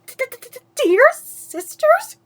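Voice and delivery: quavering. Acted. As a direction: stutter it out terrified. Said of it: "quavered Anastasia".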